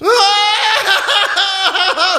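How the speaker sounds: high, wailing ghostly howl; frightful ghostly cry. Acted. Ghost wails to frighten Scrooge, a dreadful high howl. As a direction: let out a dreadful spectral wail. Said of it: "the spirit raised a frightful cry".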